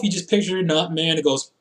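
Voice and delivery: ordinary delivery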